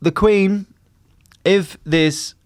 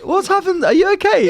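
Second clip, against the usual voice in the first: mock concern. Mock concern over word slip.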